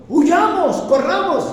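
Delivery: high, loud cry to run away; hammed-up panicked disciple urging flight; mock panic. Preacher acting a panicky Jesus he never was, mock panic. Jesus did not say flee.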